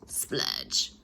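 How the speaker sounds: a very low, unvoiced gush sound; splurge noise. A narrator's reading. The narrator imitates the ice cream pump gushing out.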